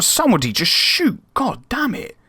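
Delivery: high, pleading then cursing; yelling at his players to shoot, exasperated; exasperated cry. Real, not acted. Chance goes begging near the end.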